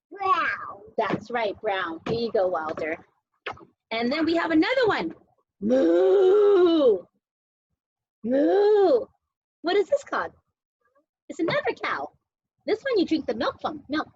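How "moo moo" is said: long, drawn-out playful moos; cow moo. Pretend. Parent showing kids another cow, long moos. Imitating a second toy cow.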